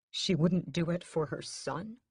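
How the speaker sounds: ordinary delivery, nothing marked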